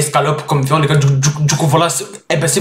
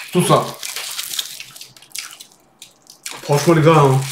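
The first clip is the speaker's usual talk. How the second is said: talking while chewing; eating a wrap; mouth full; faster than usual. Real, not acted. Seen 3: Small webcam; bites foil-wrapped food held at mouth, then chews.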